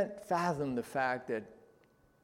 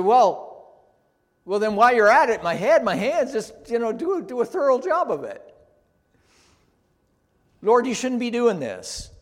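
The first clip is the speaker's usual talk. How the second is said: high, loud, gushing request; eager overreaction; 8 semitones higher, 9 dB louder than usual. Retold or imitated. Preacher voicing Peter begging Jesus to wash everything, gushing. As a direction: overdo it, grabbing for more.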